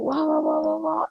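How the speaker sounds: high-pitched mock anxious wah-wah; imitated anxious babble. Mimicking anxious partner home from a business trip, high anxious babble. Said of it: "saw how anxious he was".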